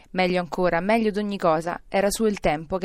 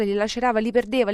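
usual speaking voice

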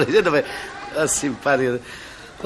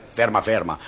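mocking repeat; 7 semitones lower than usual. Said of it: "Cosa continuai a gridare".

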